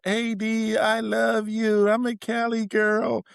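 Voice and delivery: high, slow fan squeal; wife gushing. Retold or imitated. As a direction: gush like a starstruck Cali girl. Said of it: "talking about".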